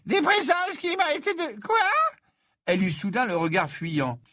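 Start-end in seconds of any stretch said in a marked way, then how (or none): 0.1-1.8 put-on high, flustered old seer; a startled, evasive prophetess, pitched way up; Trelawney's voice.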